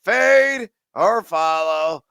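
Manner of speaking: slow, loud, drawn-out sign-off; catchphrase sign-off. Acted. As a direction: land the show tagline big.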